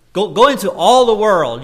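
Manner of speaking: preacher urging go; emphatic command. Shown as a demonstration. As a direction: push the Go like an order. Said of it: "the emphasis there is".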